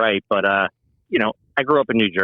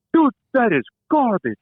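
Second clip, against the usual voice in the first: much higher, mock-disgusted Jersey owner; Guido guy. Owner scorns chain pizza: high hammed-up Guido voice. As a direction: sneer it like a brash Guido owner. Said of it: "the ultimate Guido guy".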